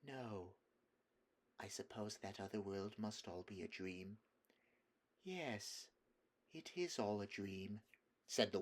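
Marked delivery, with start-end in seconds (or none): 0.1-4.2 soft, enchanted, far away; dreamy relief. A narrator's reading. Jill giving in to the enchantment, soft and dreamy. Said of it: "it was a relief to say". 5.2-7.8 soft enchanting murmur. A narrator's reading.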